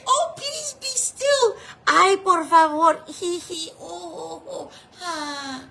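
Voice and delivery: high, weak, whimpering plea; a trembling, begging whisper of a command; timid pleading. Retold or imitated. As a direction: beg like you're afraid to ask. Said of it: "He didn't say".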